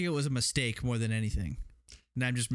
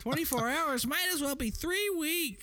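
much higher, slow squeaky outraged woman; mock lady yelling. Retelling the town-meeting woman, high and slowed. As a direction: stretch it out in a high, appalled squeal. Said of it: "lady in the back just screaming".

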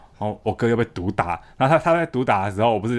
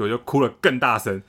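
ordinary delivery

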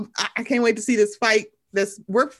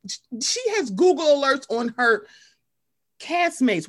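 usual speaking voice

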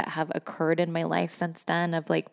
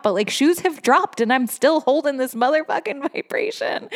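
tearful; 8 semitones higher, 3 dB louder than usual. Real, not acted. Emotional declaration.

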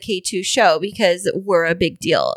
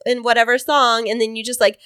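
usual speaking voice

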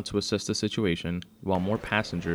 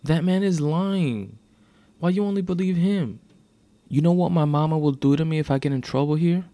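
high, loud tearful protest; distressed pleading; 9 semitones higher, 6 dB louder than usual. A narrator's reading. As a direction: plead desperately as if near tears. Tearful teen pleads with officer.

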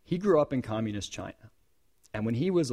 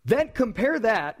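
usual speaking voice

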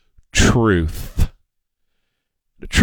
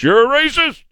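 mocking accuser; 11 semitones higher than usual.

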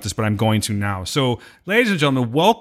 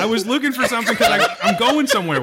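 high, laughing protest; laughing self-defense; 10 semitones higher than usual. Real, not acted. Host defending himself against teasing co-hosts, laughing protest. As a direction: plead your case through laughter.

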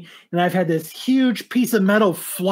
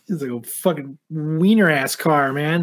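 normal talking voice

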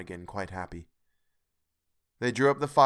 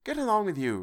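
high-pitched, good-humoured comeback; young brother's higher voice; grinning retort; 11 semitones higher than usual. A narrator's reading. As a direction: brush off the teasing with a grin. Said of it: "retorted Peter".

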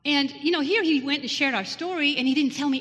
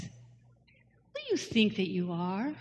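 slow, hushed and firm, like a gentle rebuke from above; God speaking; slower than usual. Retold or imitated. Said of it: "God's telling me this".

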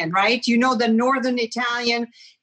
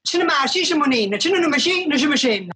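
higher, fast Southern Italian dialect chant; 3 semitones higher, faster than usual. Shown as a demonstration. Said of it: "how about this?".

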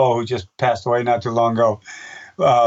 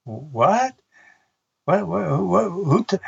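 bewildered surprise; 4 semitones higher, much slower than usual. Retold or imitated. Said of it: "I'm walking on stage going".

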